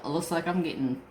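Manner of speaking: ordinary talk, nothing marked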